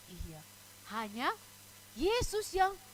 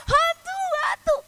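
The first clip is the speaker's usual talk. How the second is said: high-pitched acted scream of alarm; shouting; 9 dB louder than usual. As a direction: cry out loudly as if spotting a ghost. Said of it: "apa mereka teriak?". (teriakan kaget bernada tinggi)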